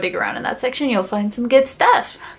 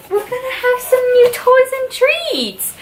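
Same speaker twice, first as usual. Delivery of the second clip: very high sing-song voice for the dog; high puppy-talk coo; 13 semitones higher than usual. Real, not acted. Telling the dog about treats.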